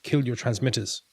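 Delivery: contained shout over the comms; urgent shout. A narrator's reading. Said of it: "Victor shouted".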